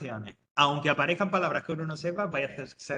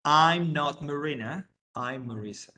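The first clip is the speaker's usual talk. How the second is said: slow model sentence; 4 dB louder, slower than usual. Shown as a demonstration. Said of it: "por lo tanto ella diría".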